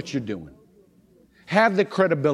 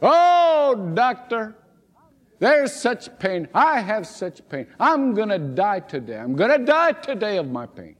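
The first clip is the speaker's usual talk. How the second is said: high, hammed-up wail of a complaining patient; moaning patient; 7 semitones higher than usual. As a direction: ham up the dying patient's moaning.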